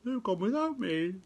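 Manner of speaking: high wailing lament; crying out. A narrator's reading. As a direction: wail it like a left-behind child. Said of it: "And he cried".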